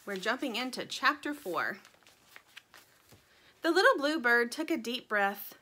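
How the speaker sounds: plain reading voice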